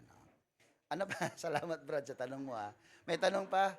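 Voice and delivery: normal talking voice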